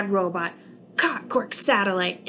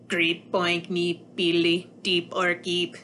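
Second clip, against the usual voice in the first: robot gibberish; 4 semitones lower, slower than usual. Acted.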